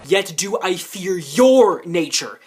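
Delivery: your pressed slow and loud, yet do I fear your nature; stress on your. Shown as a demonstration. Friend corrects a misreading, stressing your slowly. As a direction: lean on your, correcting the wrong reading. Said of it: "Not the nat-, not the nature".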